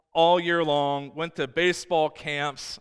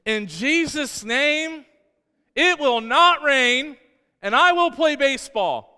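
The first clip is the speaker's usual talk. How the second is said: put-on high, slow, loud child's prayer; little boy commanding the rain in Jesus' name; nine-year-old voice; 10 semitones higher, 7 dB louder, slower than usual. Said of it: "in my nine-year-old voice".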